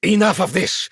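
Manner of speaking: high, loud furious shout; angry outburst. Acted. Fed-up shopkeeper shouts down a pushy questioner, furious and loud. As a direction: bark it out, patience finally gone. Shopkeeper loses patience with prying.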